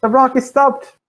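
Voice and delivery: high, loud character yell; yelling over. Acted. Game master's character yells over to a companion, yelling. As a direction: call it out to a far-off companion. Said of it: "Boehner yells over to you".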